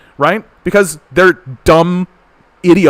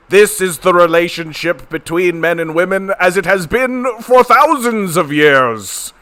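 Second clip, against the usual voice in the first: put-on pompous old patriarch. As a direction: declaim like a blustering old-fashioned lord.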